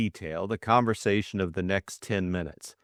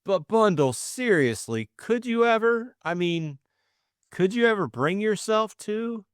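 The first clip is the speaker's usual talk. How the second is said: high, eager confession, gaining courage; nervous suitor's rush of feeling; earnest young man; 8 semitones higher than usual. Acted. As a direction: blurt out love he has hidden for ages. Bill proposes.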